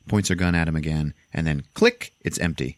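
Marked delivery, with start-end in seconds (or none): none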